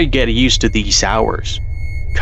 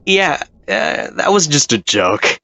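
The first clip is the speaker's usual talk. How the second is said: high nervous cover-up; awkward lie; 8 semitones higher than usual. Acted. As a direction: fake it's-a-joke voice. Paul covers up, lying.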